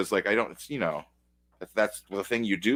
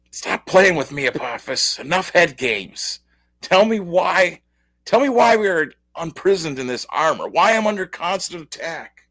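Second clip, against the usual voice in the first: raised, higher-pitched demanding voice; acted angry demand; 8 semitones higher, 4 dB louder than usual. Armor's prisoner confronts Apophis over head games, heated demand. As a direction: demand answers loudly, pitched up.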